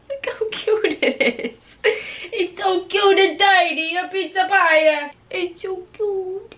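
high, cooing cuteness voice; gushing. Real, not acted. Showing viewers tiny food, high cooing. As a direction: coo over how adorable it is.

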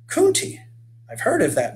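high, sudden recognition; light up as he recognizes the word; boy recognizing a word he has heard; surprised exclaim. Acted. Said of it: "Mitch exclaimed".

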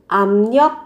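압력 said as "amnyeok", the b turned to m and the r to n; 압력 with b nasalized to m and r to n; sound change. Shown as a demonstration. Teacher showing the sound change in 압력. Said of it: "phát âm là biến âm là".

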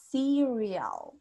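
Cereal as SEE-ree-ol, falling at the end; English intonation. Shown as a demonstration. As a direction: lean on the first syllable of Cereal and let it fall. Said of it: "Tienen que darle esa entonación en inglés".

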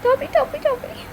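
high, soft repeated chant; small, squeaky playful echo; child imitating aunt. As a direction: mimic an aunt's catchphrase in a little high voice. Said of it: "నువ్వెలా అంటావే?".

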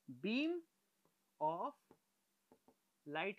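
"beam", "of", "light" set apart by long pauses; slowly feed out "beam... of... light", one word at a time; word-by-word dictation. Shown as a demonstration. Teacher repeats the term for the class, word-by-word dictation.

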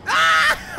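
short, loud shriek; very high yell of gamer panic; real high-pitched scream; high panicked scream. Real, not acted. Screams while stuck in game.

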